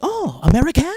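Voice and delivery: high, loud surprised French woman. Retold or imitated. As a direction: sound caught out by an American. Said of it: "the woman was just like".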